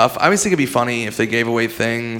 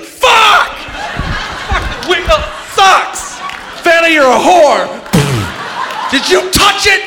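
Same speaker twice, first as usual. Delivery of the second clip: high, loud hammed-up rage shouting; losing his mind; 3 dB louder, much slower than usual. Comic acting out raging at the wheel and Vanna. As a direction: rant like a furious contestant. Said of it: "I would lose my mind".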